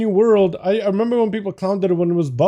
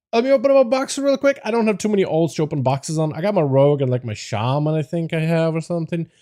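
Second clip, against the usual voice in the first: normal talking voice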